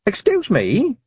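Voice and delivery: put-on high girlish call across the water. A narrator's reading. Tiffany calling after the little men, high girlish call. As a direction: call out to the boat like a young girl. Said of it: "Tiffany shouted".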